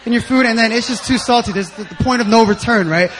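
loud, laughing sermon delivery; booming pulpit voice with laughter; full-force preaching. Acted. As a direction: preach it out big and jovial.

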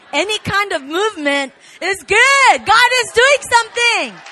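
loud, high preaching climax; fiery preaching. Real, not acted. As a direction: preach it to the rafters. Pastor preaching at full force.

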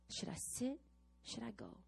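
hushed back-and-forth worrying; inner dithering. Retold or imitated. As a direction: mutter the dilemma to yourself. Inner debate on her chair.

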